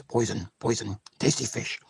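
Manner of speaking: low, soft imitation of a character; a soft, low Simpsons character quote. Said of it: "Remember The Simpsons?".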